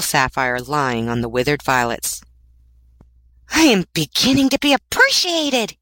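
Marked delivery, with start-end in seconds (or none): 3.5-5.8 high-pitched delighted exclamation; excited cry. A narrator's reading. Student finding a sapphire on his desk, excited cry. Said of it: "he cried".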